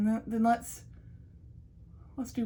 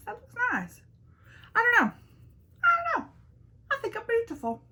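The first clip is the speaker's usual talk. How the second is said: playful vanity; 14 semitones higher, faster than usual. Pretend. Teasing self-admiration.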